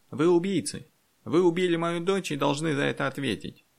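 high, loud outraged cry; enraged village elder crying out; furious accusation. A narrator's reading. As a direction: accuse them at a high-pitched shout. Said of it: "Старосту перекосило от злости".

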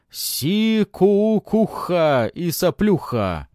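slow, loud, high chanted taunt. A narrator's reading.

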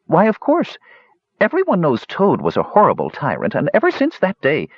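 bright high kender voice; high chirpy kender. Acted.

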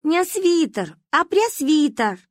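high, slow indignant correction; cross correction. Acted. Angry girl corrects the kids' mistake, slowly. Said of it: "рассердилась девочка".

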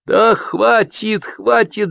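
high-pitched protesting cries; shouting. A narrator's reading. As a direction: guests crying out to stop it. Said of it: "закричали гости".

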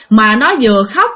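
ordinary delivery

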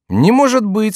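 high, loud disbelief; astonished high cry; incredulous. Acted. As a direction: exclaim it far up in pitch. Chicot astonished by the key.

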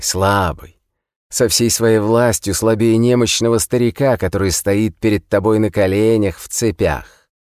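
high, quick jeering tone; mock his helplessness; taunting prisoner. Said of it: "издевался". (издевательский высокий тон)

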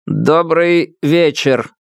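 lightly voiced synthesizer greeting; synthesized voice. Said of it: "синтезированный голос".

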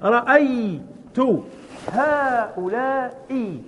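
each word stretched out very slowly for students to copy; slow, drawn-out dictation of an example sentence. Shown as a demonstration. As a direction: drag out every syllable like dictating to a class. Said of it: "مثال الثاني".

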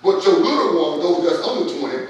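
loud, high preacher voice throughout; rousing the congregation against murmuring; full-force preaching. Acted.

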